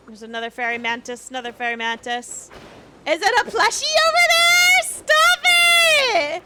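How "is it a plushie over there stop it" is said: excited high squeal. Real, not acted. Excited squeal at rare drop.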